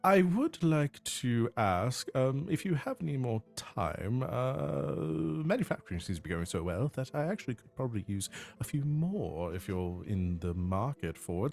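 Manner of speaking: sweeten the offer with polite airs; courteous nobleman. Acted. Baron Steele speaks on device.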